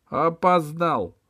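высокий протяжный окрик судьи; останови опоздавшего громким окриком; громкое возражение. A narrator's reading. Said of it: "воспротивился главный судья". (громко и высоко возражая)

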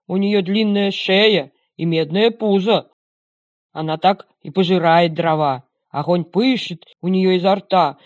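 put-on loud gruff old watchdog; old dog voice. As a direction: describe like a proud old dog. Dog describes the stove.

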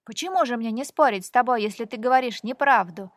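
громко и быстро спорит; отвечай громко и дерзко; громкий вызов. Acted. (громкий дерзкий спор)

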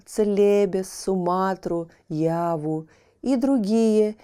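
ordinary delivery, nothing marked

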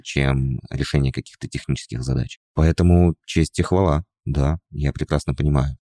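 usual speaking voice

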